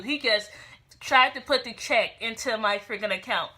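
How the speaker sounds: ordinary delivery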